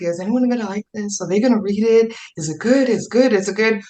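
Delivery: worried author fretting over a draft; anxious writer. Retold or imitated. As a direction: voice the nervous writer asking if it's any good. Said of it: "and we're like".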